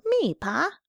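put-on high, small child voice; little boy. A narrator's reading. Small son answering his father in the dark, childlike. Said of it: "A little boy came running down".

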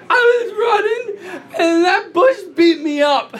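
high-pitched mock crying child; tearful little kid. Four-year-old tells father his exact words, mock tearful. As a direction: imitate a small boy wailing out his complaint. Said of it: "he's just tears".

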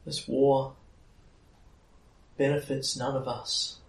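high, grieving fairy queen with long pauses; Titania, sad. Acted. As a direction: plead for peace in a high, sorrowful voice. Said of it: "there is sadness in her voice".

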